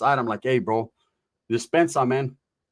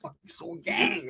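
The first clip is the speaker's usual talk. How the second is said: hushed threat; 8 dB quieter, slower than usual. Retold or imitated. Retold threat heard from distance.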